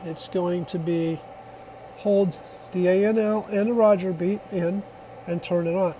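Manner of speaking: ordinary talk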